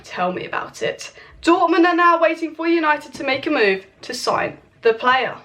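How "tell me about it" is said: whispered, slow and much softer; sarcastic aside. Real, not acted. To viewers about United's slowness, a whispered sarcastic aside. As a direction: breathe it out as a weary dig.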